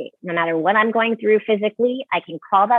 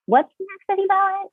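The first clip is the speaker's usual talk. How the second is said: high, airy and self-assured; breezy confidence; 13 semitones higher than usual. Retold or imitated. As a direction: toss it off like nothing fazes you. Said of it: "And I was like".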